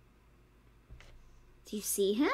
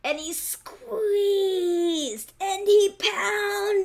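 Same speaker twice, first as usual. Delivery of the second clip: very slow, higher, straining words; slow effortful narration; 8 semitones higher, 6 dB louder, much slower than usual. A narrator's reading. Narrator shows the fox's hard work, very slow. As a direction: drag out each verb like hard work.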